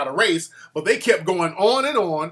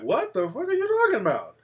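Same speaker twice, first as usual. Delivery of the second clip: higher, disbelieving outburst; incredulous; 5 semitones higher than usual. Retold or imitated. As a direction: ask it in total disbelief. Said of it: "people on the panel were like".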